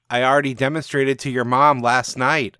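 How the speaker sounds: loud cocky retort; shouted comeback. Acted. Frankie shouting back at the heckling teen, loud retort. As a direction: fire the insult back at the teen. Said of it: "I shout back".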